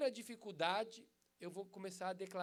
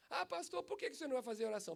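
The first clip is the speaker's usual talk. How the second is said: high, fast pleading mother voice; desperate mother; 7 semitones higher, 3 dB louder, much faster than usual. Retold or imitated. Mother protests to the pastor, high whiny plea. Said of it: "a mulher desesperada".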